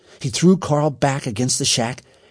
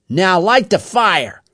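slow, loud, higher order; drawn-out command from the gang leader; 7 semitones higher, 6 dB louder, slower than usual. A narrator's reading. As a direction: order it loudly, taking your time.

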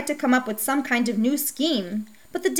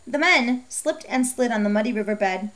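usual speaking voice

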